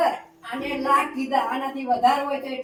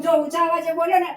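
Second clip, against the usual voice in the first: usual speaking voice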